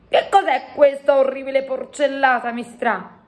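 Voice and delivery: disgusted giant. A narrator's reading. Giant protesting the coffee to the queen, disgusted. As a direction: complain about a revolting drink.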